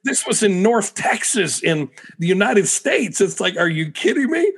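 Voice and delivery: high-pitched, exasperated disbelief; incredulous. Retold or imitated. Preacher recalls being rebuked in North Texas, incredulous. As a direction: retell your disbelief, pitch jumping up. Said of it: "was, like, so ignorant".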